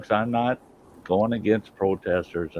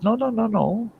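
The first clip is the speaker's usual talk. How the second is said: high, squeaky, frantic no's; panicked refusal; 12 semitones higher than usual. Retold or imitated. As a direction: boyfriend squeals no in a panic at the girl. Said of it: "And he says".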